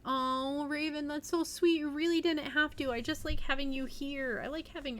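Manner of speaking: real delighted thanks; touched thanks. Real, not acted. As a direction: gush back at a generous viewer. Thanking Raven again.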